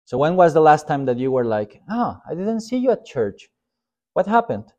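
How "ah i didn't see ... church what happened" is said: high-pitched, curious churchgoer's question; mock surprise. Pastor plays churchgoer asking about a missed service, high mock surprise. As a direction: act a concerned, surprised inquiry.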